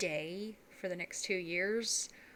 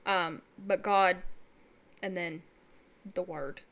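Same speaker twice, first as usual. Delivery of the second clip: ordinary delivery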